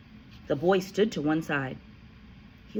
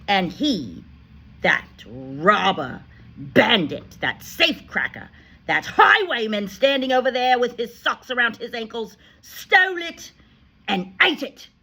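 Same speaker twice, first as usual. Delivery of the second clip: loud, higher accusing rant; 7 semitones higher, 8 dB louder than usual. A narrator's reading. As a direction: denounce him to the hall. Headmistress accuses boy to school.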